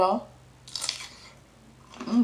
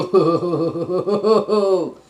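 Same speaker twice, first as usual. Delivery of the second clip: happy laugh; 8 dB louder than usual. Real, not acted. Laughs after bite.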